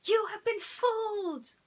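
very high, loud frantic cry; snake yell. Acted. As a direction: shriek the warning like a panicked serpent. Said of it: "yelled Hiss".

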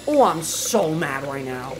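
low, slow seething with SO stretched; drawn-out mad. Real, not acted. Streamer fed up with the shift, slow mad grumble.

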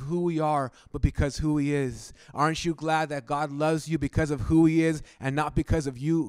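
rising preacher's fervor toward the congregation; fervent preaching. Acted.

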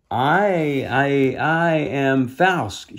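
a touch of a slow, stammering fairy; a frightened little fairy, mildly voiced; faltering start, understated fear; shakily. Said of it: "she began shakily".

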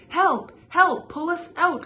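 high, loud panicked shouts; cries for help. Acted. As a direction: yell for help from inside the wok. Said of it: "cried his parents".

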